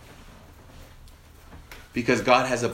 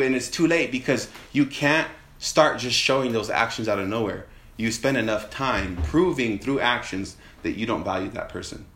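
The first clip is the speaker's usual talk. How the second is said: ordinary talk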